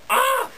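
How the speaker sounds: high-pitched acted scream; startled cry. Storyteller as Minnie seeing the hanging cow, high startled cry. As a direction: cry out high in mock shock. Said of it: "And she goes".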